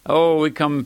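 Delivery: normal talking voice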